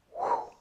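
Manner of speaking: short, high whistly whew; quick airy "whew" sound effect; imitated whoosh. Shown as a demonstration. Showing students the mind is not focused, whew sound.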